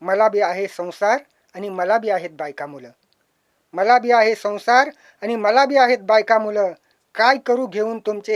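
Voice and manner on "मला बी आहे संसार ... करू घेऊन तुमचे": louder recited plea. Acted. Scrap dealer pleading with the poet, louder. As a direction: push the words out louder.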